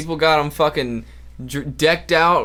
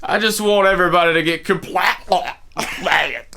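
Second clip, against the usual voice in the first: put-on throaty joke Scottish accent, breaking up; mock Scottish accent. Co-host topping the joke, mock Scottish accent. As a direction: ham up a Scottish brogue until it cracks. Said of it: "like, Scottish".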